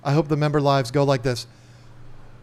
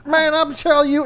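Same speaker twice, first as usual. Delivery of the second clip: Boomhauer impression; 13 semitones higher, 5 dB louder than usual. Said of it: "he sounds like Boomhauer".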